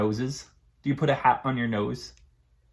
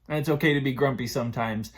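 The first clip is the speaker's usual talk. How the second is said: ordinary delivery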